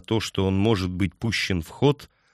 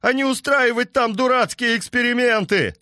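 angry farmer yelling. Acted. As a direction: bellow it like a furious farmer.

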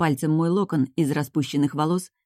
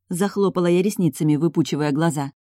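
usual speaking voice

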